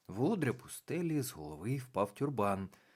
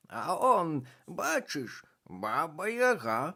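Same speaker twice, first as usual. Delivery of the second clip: higher, louder squawky bird voice; raven voice; 8 semitones higher, 5 dB louder, slower than usual. A narrator's reading. Абраксас показує малій бабі Язі, високий пташиний голос. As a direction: play the raven pointing something out. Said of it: "раптом сказав Абраксас".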